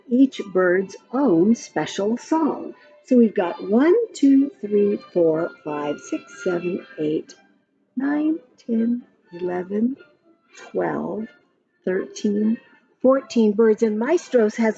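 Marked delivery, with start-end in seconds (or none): none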